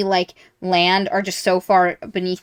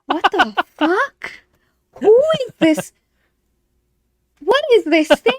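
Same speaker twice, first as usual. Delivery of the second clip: high, louder bewildered questions; baffled and spooked, hammed up; freaked-out disbelief; 11 semitones higher, 4 dB louder than usual. Reader meets the red skeleton.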